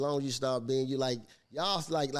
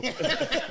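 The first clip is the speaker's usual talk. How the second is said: high burst of laughter; big laugh; 13 semitones higher, 3 dB louder than usual. Real, not acted. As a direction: crack up at the joke. Laughing at the joke.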